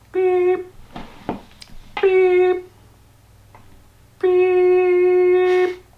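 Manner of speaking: high, drawn-out beeps; slow keypad beeps. Retold or imitated. Imitating the keypad on his third careful try. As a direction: beep slowly, with tension.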